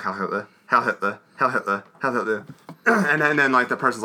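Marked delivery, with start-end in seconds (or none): 0.0-2.4 a lightly voiced, repeated salute chant; mock Gestapo salute. Retelling the film's Gestapo scene, lightly voiced chant. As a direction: rattle off the salutes like a drilled soldier.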